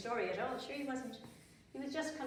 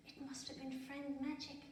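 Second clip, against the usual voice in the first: quiet, higher inner voice; soft thought; 5 semitones higher, 7 dB quieter than usual. A narrator's reading. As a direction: think it softly to herself. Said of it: "but she thought".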